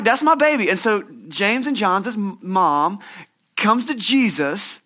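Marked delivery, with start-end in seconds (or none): 0.0-0.7 proud mama. Retold or imitated. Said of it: "They're like".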